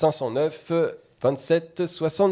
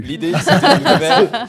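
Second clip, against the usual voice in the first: laughing; 6 semitones higher, 12 dB louder, slower than usual. Real, not acted. Hosts joking about hypnosis.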